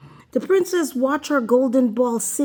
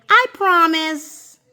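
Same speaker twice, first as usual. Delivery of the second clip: higher and louder insistent promise; a hasty second promise, raised; emphatic promise; 8 semitones higher, 5 dB louder than usual. A narrator's reading. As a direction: say it again, pushing harder. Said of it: "she said it again".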